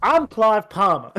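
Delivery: slow, high mock campaign-ad sign-off; Clive Palmer. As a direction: drawl it loudly over imagined country music. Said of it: "country music playing in the background".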